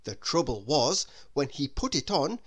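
ordinary delivery, nothing marked

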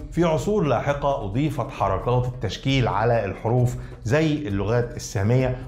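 ordinary delivery

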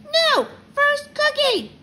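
put-on high, loud, slow toddler tantrum; insistent little kid. A narrator's reading. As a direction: squeal the refusal, drawing out each word.

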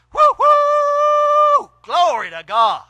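high loud woo then hollered glory; whooping shout. Acted. Acting out a preacher bursting with the Spirit, whooping. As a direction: boil over like a preacher who can't help it. Acts out a preacher boiling over.

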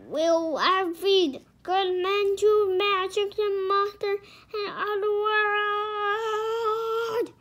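high, loud, dragged-out ending; angry outburst. A narrator's reading. King Arthur refusing to send good men, shouting. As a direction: rant it out, stretching the end.

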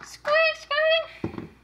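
very high squeaky bunny; one piercing little bunny squeak; chocolate bunny squeak. Pretend. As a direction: squeak like a toy rabbit. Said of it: "the chocolate bunny is back".

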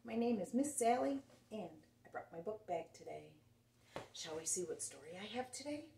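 ordinary delivery

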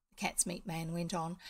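ordinary delivery

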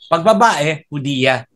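ordinary talk

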